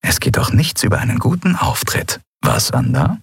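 dry quip. A narrator's reading. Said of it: "Endriel lächelte trocken".

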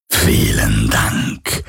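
low, very slow ghostly voice; menacing phantom. A narrator's reading. The phantom mocks Garland's bluff, low and slow. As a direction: let it drip with dark amusement.

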